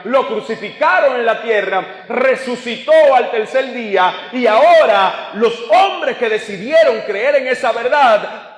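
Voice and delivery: high, forceful sermon delivery; preaching. Real, not acted. Predica con fuerza sostenida. (predicando con fuerza, en tono alto)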